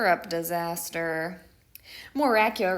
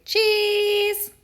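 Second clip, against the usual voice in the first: high, loud mock cheese; bright loud cheese; 11 semitones higher, 5 dB louder than usual. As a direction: shout it like posing kids. Classmates say cheese.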